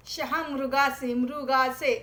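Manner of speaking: slow शहामृगाचे, then मृगाचे stressed; careful pronunciation. Shown as a demonstration. Teacher modelling शहामृगाचे for students. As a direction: sound out मृगाचे slowly and firmly. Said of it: "उस उच्चारण पे आपको ध्यान देना है".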